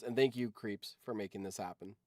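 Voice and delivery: ordinary delivery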